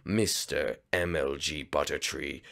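low, slow gloating villain voice; villain narrator. Acted. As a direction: gloat to the audience like a scheming villain. Villain addresses the audience.

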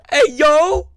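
high, loud startled call; alarmed shout. Real, not acted. Player startled while hiding, alarmed shout.

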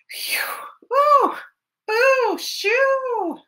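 hammed-up reaction to skunk spray; mock disgust then shoo. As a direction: react to the stink, then chase it off.

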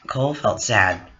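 plain reading voice

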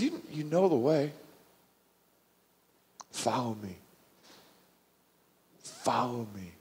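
very soft, unhurried answer; calm reassurance. Retold or imitated. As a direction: quietly settle a panicked follower. Jesus calmly answers frantic Thomas.

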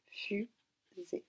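"Fusée" répété plus doucement, fu... sée; séparer les deux syllabes de "Fusée", en retenant la voix; découpé en syllabes. Shown as a demonstration. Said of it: "Donc on écoute bien". («Fusée» répété doucement, syllabe par syllabe)

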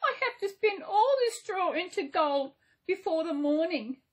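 put-on high, tearful young girl's reply; sadly. A narrator's reading. Girl tells the little man her task, high and sad. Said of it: "replied the girl sadly".

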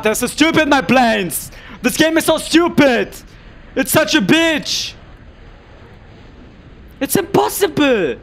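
loud high angry outburst; furious ranting. Real, not acted. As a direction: rage at the game, shouting. Raging at losing game.

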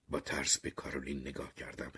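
usual speaking voice

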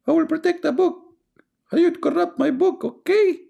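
very high squeaky mock god voice; mock divine voice. Streamer mocking the caller's God, shrill mock voice.

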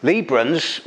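normal talking voice

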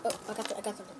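ordinary delivery

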